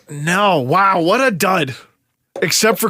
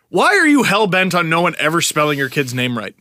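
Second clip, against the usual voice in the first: ordinary delivery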